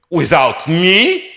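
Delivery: put-on loud, slow, rising French-accented disbelief; indignant French outburst. Conductor springing up off his couch, loudly incredulous. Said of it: "he sprung up and he went".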